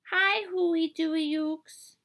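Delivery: put-on lightly high, slow toddler voice; mispronouncing like a tiny boy; little boy. Little Nathan greeting the cat, slow and high. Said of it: "Nathan bent down and said".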